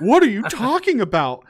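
high, loud mock-panicked outburst. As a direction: burst out in exaggerated disbelief and panic. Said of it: "shot up in panic and kept saying".

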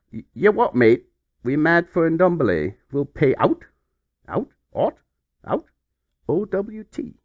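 loud, cheeky Mancunian accent, lightly put on; mock Mancunian accent. Reading Man United's fax in a mock Mancunian accent. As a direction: bark it like a Manchester lad. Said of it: "what kind of accent is this".

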